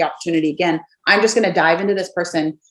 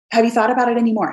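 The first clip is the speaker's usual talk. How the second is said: quick, upbeat ask; brisk follow-up; 3 semitones higher, faster than usual. Shown as a demonstration. Checking back in with a prospect, quick and casual. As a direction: rattle it off lightly.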